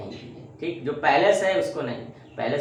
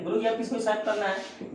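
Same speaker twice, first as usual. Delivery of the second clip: a light, higher-pitched schoolkid voice; pupil asking; 7 semitones higher than usual. Acted. Teacher voicing a pupil's question, lightly higher pitched.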